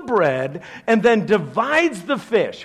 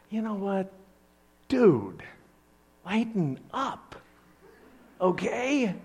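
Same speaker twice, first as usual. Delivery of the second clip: ordinary delivery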